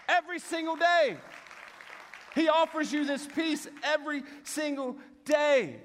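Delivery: high-pitched, emphatic preacher delivery; impassioned preaching. Real, not acted. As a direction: drive the point home to the congregation. Preaches the point with force.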